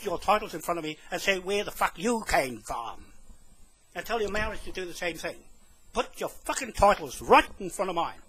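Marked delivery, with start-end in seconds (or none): none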